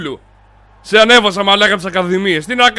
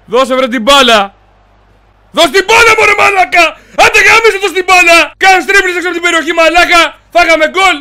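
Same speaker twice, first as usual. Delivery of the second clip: loud, high-pitched furious yelling; angry shouting; 10 semitones higher, 7 dB louder than usual. Real, not acted. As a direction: scream at a player who won't pass. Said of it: "Μη φωνάζεις εδώ στο μικρόφωνο". (θυμωμένες φωνές)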